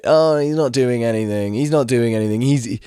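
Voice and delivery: lightly mocking a careless dog owner; mimicking the owner. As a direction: parody her breezy excuses. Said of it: "she starts going like".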